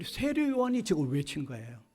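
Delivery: usual speaking voice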